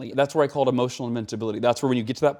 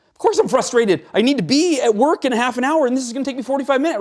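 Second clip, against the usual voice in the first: higher and louder, exasperated rant at the traffic; frustrated outburst; 12 semitones higher, 6 dB louder than usual. Acted. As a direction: act out the stressed driver stuck in traffic, building to a frustrated rant. Acting out frustrated driver.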